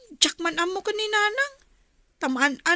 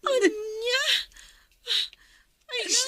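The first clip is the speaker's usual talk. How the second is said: shocked cry; 5 semitones higher, slower than usual. Acted. Shocked reply, cry heard.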